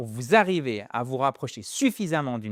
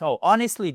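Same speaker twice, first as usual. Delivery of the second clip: "honestly" stressed on the first vowel, higher and louder; 4 semitones higher, 6 dB louder than usual. Shown as a demonstration. Teacher demonstrating the stress in an example sentence. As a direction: punch the start of "honestly". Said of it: "une accentuation".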